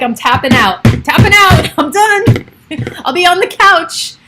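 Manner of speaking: loud, high-pitched surrender; mock giving up. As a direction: call it out like an exhausted parent quitting, hammed up. Acts out giving up as parent.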